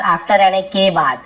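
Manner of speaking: normal talking voice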